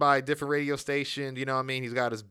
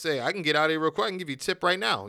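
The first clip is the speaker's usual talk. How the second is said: fast eager pitch; 3 semitones higher, faster than usual. Retold or imitated. Retold bribe offer, misreading cop.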